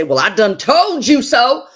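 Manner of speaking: hammed-up told-you-so; mocking know-it-all. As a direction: sneer it like a smug nag. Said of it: "please don't ever say".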